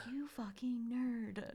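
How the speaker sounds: slow fond whisper. Retold or imitated. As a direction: breathe it out like a secret insult. Affectionate aside about Warren.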